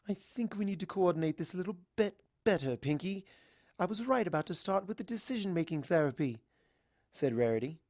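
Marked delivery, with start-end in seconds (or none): 0.1-6.4 a hint of a genteel lady; lightly voiced polite unicorn; lightly voiced refined mare. Rarity voiced in story.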